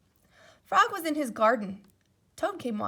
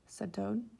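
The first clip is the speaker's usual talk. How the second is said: ordinary delivery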